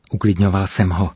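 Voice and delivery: plain reading voice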